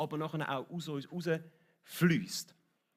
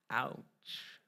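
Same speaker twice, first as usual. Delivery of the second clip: mock ouch; 2 semitones lower, 5 dB quieter than usual. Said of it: "so ein bisschen ouchmässig".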